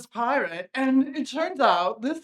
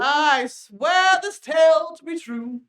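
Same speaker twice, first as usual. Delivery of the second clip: slow, louder, ceremonious swearing; a grand formal vow; solemn oath; 5 semitones higher, 6 dB louder than usual. Acted. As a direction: swear it before the court.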